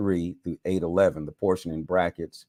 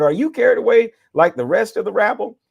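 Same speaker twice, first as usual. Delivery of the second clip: high, loud contemptuous rebuke; scornful scolding; 12 semitones higher, 7 dB louder than usual. A narrator's reading. Pharisees scolding the temple police, loud and scornful. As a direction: sneer it down at them.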